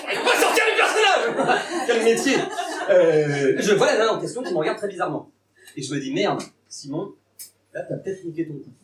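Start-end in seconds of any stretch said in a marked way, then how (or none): 0.1-2.4 mock self-heckle.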